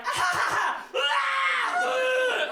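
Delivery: high, loud wailing shriek; baby shriek. Retold or imitated. Baby daughter startled by dad, high loud wailing shriek. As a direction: shriek through every emotion like a baby. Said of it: "was just like".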